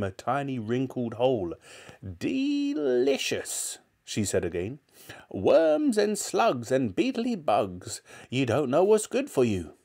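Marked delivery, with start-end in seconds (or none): 2.0-3.8 very high, long drawn-out savouring; relishing grandmother. A narrator's reading. 5.3-9.7 put-on high old-woman voice, slow savouring start; relishing grandmother. A narrator's reading. Grandmother relishing disgusting food.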